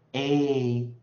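A held long, loud and steady; long vowel demonstrated. Shown as a demonstration. Teacher showing what a long vowel is, A drawn out loudly. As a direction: sustain the letter name A at full volume. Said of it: "我们讲的长母长元的时候啊".

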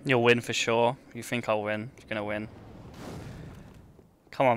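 ordinary talk

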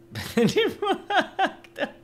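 a high, loud burst of laughter. Real, not acted. Laughing at his own drawing of the wife, a high burst. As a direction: crack up at the face he drew.

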